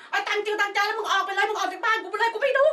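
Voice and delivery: high, rough telling-off in mock fury; angry mother yelling at the salesman; retold angry shout. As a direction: throw him out of the house, loud and high. Chasing salesman out of house.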